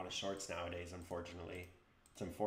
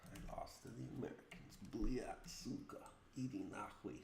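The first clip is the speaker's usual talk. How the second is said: put-on Russian accent; 5 dB quieter than usual. Russian swearing after losing.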